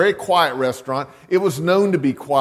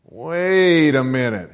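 slow wait a minute; slower than usual. Retold or imitated. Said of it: "he said".

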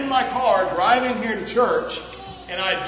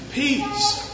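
1 high drawn-out word; 13 semitones higher than usual. Real, not acted. Pastor stresses key word to children.

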